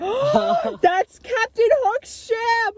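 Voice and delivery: high, very slow gasping reveal; gasp, then stretch it out; gasp of excitement. Real, not acted. Telling a friend about the big plot twist.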